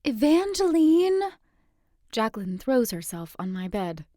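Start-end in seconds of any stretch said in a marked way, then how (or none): 0.0-1.2 loud drawn-out call, high. Acted. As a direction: call her name across the room. Friend calls narrator awake.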